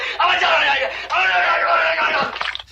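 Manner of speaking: high wailing mock laugh; shrieking falsetto laugh; hysterical falsetto shriek-laugh. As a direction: cackle in hysterics. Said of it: "I'm hysterical".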